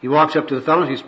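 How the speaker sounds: ordinary talk, nothing marked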